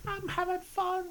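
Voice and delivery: high, soft little voice; a small, high, quiet cheer; mock happy player. Kid delighted by fire tokens, high mock-happy voice.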